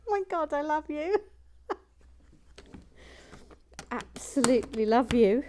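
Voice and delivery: high, laughing gushing. Real, not acted. Host laughing with delight at Sharon's comment. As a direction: gush through laughter.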